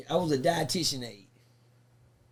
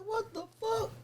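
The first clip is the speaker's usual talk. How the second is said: high, quiet squeak of disbelief; stunned disbelief; 8 dB quieter, slower than usual. Retold or imitated. Told to put hands out, high stunned squeak. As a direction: react in high, hushed shock.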